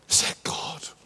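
breathy unvoiced question; whisper. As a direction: ask it in a hushed whisper. Awed question about the voice. (a hushed, awed whisper asking about the voice)

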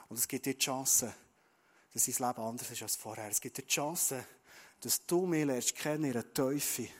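Jesus gently promising a fresh start to the listener; gentle reassurance. Acted. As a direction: speak it close and reassuring.

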